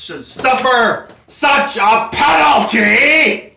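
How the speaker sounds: loud, high, slow protest to the court; indignant courtroom cry; outraged shout. Acted. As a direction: hammer out each word in a shout. Defendant protests his sentence.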